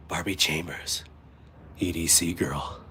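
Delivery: low, slow attempted sexy voice. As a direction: try a low seductive purr, slowly. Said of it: "I can't even make a sexy voice".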